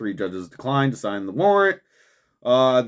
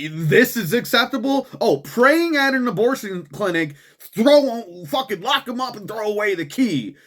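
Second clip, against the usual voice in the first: sarcastic mock; 9 semitones higher than usual. Sarcastic mock of the other side.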